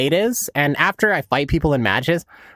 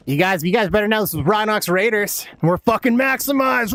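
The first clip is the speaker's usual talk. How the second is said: taunting shout; 4 semitones higher than usual. Real, not acted. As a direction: yell it at the enemy squad. Taunting enemies on proximity chat.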